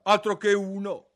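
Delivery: high, loud affronted retort; indignant. A narrator's reading. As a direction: huff it out, offended. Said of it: "disse il ghiro indignato".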